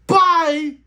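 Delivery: high, loud, joking goodbye yell; mock farewell shout. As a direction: shout it off like waving someone away. Sending a kid to the orphanage.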